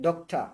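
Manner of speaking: "doctor" ending in an open "ta", no r; correct "doctor" pronunciation. Shown as a demonstration. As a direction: repeat the model "dok-ta" without r. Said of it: "আপনি উচ্চারণটা বলেন". (সঠিক উচ্চারণে "ডক্টা", র বাদ দিয়ে)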